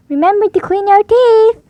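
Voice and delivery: loud, high call up the stairs. Acted. Mummy Pig calling the children upstairs. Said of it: "Mummy Pig called".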